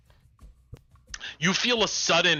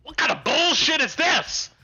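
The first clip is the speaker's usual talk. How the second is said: angry goblin rant; 7 semitones higher, faster than usual. Acted. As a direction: blow up like a cheated crime boss. Goblin boss rants at the party.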